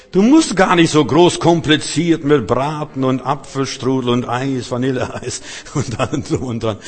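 loud, ringing sermon voice; fiery preaching. Acted. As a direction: preach it out to the whole hall. Preaching at full force.

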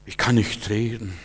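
slow, soft, low mock helplessness; small, defeated voice; lame excuse. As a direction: make a feeble excuse. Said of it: "das sind unsere faulen Ausreden".

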